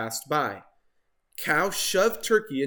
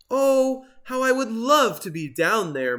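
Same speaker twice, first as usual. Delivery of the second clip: moaning; 8 semitones higher, 5 dB louder than usual. Acted. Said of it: "moaned Turkey".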